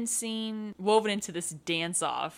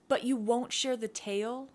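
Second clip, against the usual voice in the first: higher, mildly pouty question; pouting; 5 semitones higher than usual. A narrator's reading. Serenity begging him for the story, pouting. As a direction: coax with a pout. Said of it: "Serenity pouted".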